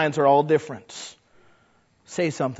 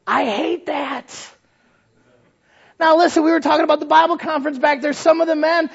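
ordinary talk

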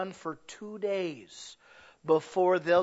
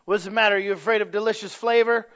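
loud, coaxing peer-pressure taunt, higher; tempting fish voice; 5 semitones higher, 7 dB louder, faster than usual. Retold or imitated. Fish taunting a wary friend at the bait, loud and coaxing. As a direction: egg him on like a tempter fish. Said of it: "the other fish goes".

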